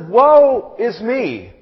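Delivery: slow, loud, drawn-out lament; anguished lament. Retold or imitated. Isaiah crying out before God, a slow anguished woe. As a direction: groan it out like a broken prophet. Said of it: "He says".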